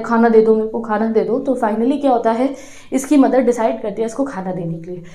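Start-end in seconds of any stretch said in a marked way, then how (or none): none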